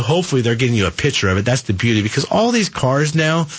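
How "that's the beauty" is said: usual speaking voice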